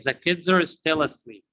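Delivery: put-on "kids are" as one word, "kidzer"; "are" shrunk to an R after "kids"; linked reduced are. Shown as a demonstration. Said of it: "hear it like that".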